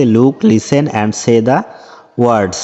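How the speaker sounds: very slow, careful English reading; slow read-aloud. Shown as a demonstration. Teacher reading the textbook instruction slowly to pupils. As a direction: spell it out slowly for the class.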